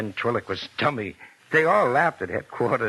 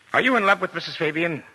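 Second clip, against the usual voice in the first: pointed accusation; 6 semitones higher, faster than usual. Acted. Detective's pointed accusing question.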